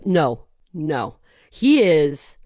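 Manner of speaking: normal talking voice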